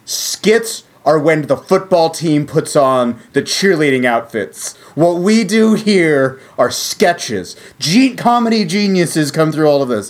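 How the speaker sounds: lofty, drawn-out correction, acted; peak Sorkin speechifying. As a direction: deliver a self-important TV monologue, slowly. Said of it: "this is peak Sorkin".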